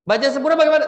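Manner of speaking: ordinary delivery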